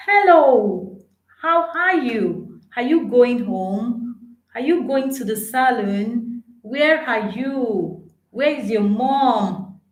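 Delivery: high, lively questions with rising tunes. Shown as a demonstration. Teacher showing friendly questions with a clear rise. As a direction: ask each one with a lifted voice. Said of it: "when you want to ask a question, ask a question".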